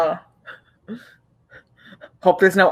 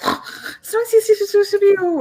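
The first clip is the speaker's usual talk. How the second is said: creature squeak. Pretend. Creature lets out a little sound.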